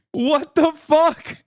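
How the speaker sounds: slow, high, drawn-out what the fuck; stunned disbelief. Real, not acted. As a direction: let the disbelief stretch out slowly. Stunned reaction.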